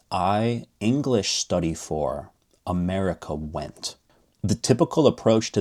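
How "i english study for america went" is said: I English study for said slowly, stress on English; slow word-by-word Hindi order in English; slow demo. Shown as a demonstration. Teacher slowly modeling Hindi word order in English. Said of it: "you say".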